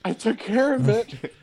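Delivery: high, light mock character voice; a hint of a goofy movie character; movie quote voice. Quoting Harry to friends, lightly voiced, high and mock.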